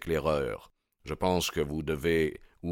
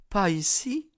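put-on high girlish question; Etty, questioning. A narrator's reading. Young woman questions her suitor's plan, high uneasy voice. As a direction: ask it in a much higher woman's voice.

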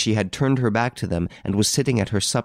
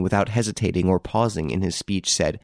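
ordinary delivery

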